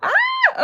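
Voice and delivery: high excited squeal. Real, not acted. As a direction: squeal with joy. Excited squeal over new subs.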